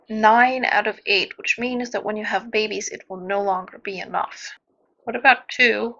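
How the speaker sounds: usual speaking voice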